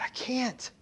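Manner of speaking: soft whispered despair; whispered I can't. Acted. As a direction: barely whisper it. Debtor's hopeless reply.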